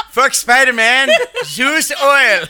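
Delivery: high, loud, laughing; hammy ad pitch; commercial voice. Pretend. Joking commercial voice riff.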